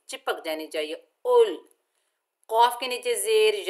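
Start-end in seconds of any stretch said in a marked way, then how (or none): 1.3-1.7 उल with the lam held still, no echo. Shown as a demonstration. Teacher demonstrating a still lam in उल. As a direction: stop firmly on उल. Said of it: "जबान मखरज में जम जानी चाहिए".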